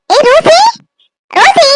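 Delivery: high-pitched call to a friend. Acted. Calling the friend heard crying. (உயர்ந்த குரலில் கூப்பிடுதல்)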